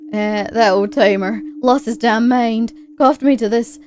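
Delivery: put-on old-timer's weird drawling accent; put-on old-timer accent, punched hard. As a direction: play a grizzled character in a hammy accent. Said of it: "do it with the weird accent".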